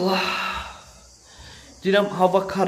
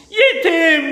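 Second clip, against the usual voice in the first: laughing; 11 semitones higher, 6 dB louder than usual. Real, not acted. Playful wordplay.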